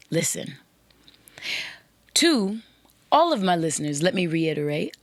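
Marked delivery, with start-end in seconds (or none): 0.1-0.6 Listen said low and slow with a falling end; emphasized. Shown as a demonstration. Host stressing the key word to women listeners, weighty. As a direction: land hard on Listen, then stop. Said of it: "Can't emphasize the word enough".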